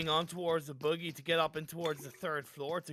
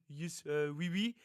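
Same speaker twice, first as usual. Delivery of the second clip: a jokey, hammed-up French accent, slightly higher; mock French accent; 4 semitones higher, 3 dB louder than usual. Caster mocking a player's self-grenade as a French genius, hammed-up French accent. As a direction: play a pro gamer in an over-the-top French accent. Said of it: "in his French accent".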